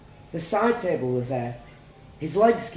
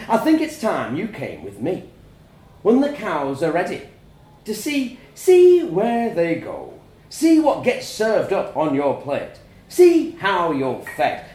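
rising voice; 5 semitones higher, 9 dB louder than usual. A narrator's reading. Father to his son, voice rising louder. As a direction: let the anger climb line by line. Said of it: "his voice was rising".

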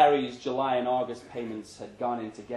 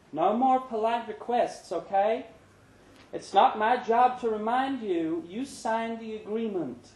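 higher woman's voice, firm; firm Brenda; 9 semitones higher than usual. Acted. Brenda reminding Larry of payments, lightly voiced and firm. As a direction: lay down the rules kindly.

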